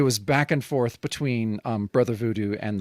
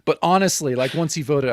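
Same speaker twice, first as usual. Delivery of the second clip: ordinary talk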